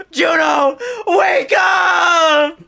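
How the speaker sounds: high, loud wailing yell, re-enacted; crying, upset shout. Upset girlfriend yelling at sleeping boyfriend, wailing shout. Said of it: "I was crying. I was upset".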